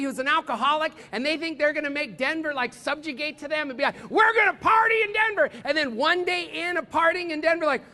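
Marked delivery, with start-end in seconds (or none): 4.1-5.5 high, loud party-animal yell; hammed-up drunken boast, shouted high; rowdy party shout. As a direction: whoop it out like a visiting partier. Visiting drunk friend's boast, acted.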